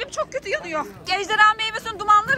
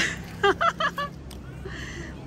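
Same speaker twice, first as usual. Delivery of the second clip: airy laugh; 7 semitones higher, 7 dB quieter than usual. Real, not acted. Laughing at smoke.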